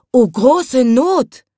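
flower woman spotting him in the sky, wailing; alarmed cry. Acted. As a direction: cry out in dismay like a frightened old flower woman. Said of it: "rief die Blumenfrau".

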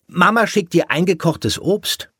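put-on high child's call; high-pitched, eager announcement. A narrator's reading. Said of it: "rief Willi".

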